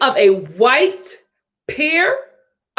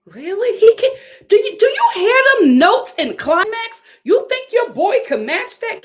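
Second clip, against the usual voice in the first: incredulous; 11 semitones higher than usual. Real, not acted. Mocking JT fans, incredulous.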